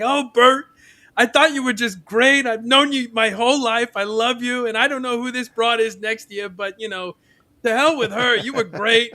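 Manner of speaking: high, loud, effusive imitation; gushing admirer. Fat guy raising his hand to praise his idol, gushing.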